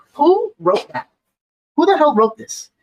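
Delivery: high-pitched, incredulous questioning. Real, not acted. Reacting to the statement just read, incredulous.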